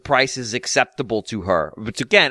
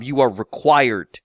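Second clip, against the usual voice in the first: ordinary talk, nothing marked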